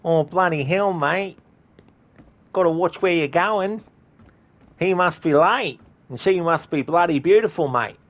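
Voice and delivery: put-on high, loud Australian bloke accent; a bystander knocked into, complaining in broad Aussie; Australian passer-by grumbling about a rushing stranger, high and loud; Australian NPC voice. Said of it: "N P C dialogue".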